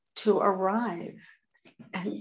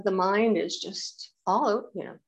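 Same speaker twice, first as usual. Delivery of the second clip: normal talking voice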